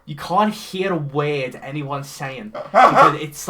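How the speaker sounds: ordinary talk, nothing marked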